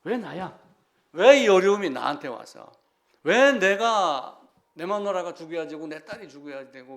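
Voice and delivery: loud, anguished protest, acted out; retold shouting. Preacher voices bereaved man railing at heaven, loud retold protest. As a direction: hit each "why" hard as a mimicked outcry. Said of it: "소리 지르는 내용이 나와요".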